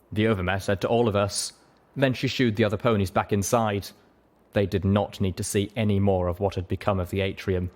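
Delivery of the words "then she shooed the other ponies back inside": plain reading voice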